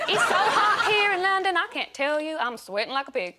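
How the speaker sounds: high, loud, hammed-up American small talk; American accent. Call centre worker chats to a caller, hammed-up American accent. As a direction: gush in an over-the-top American accent. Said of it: "I wasn't expecting to speak to an American".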